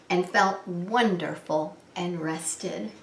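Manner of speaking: ordinary delivery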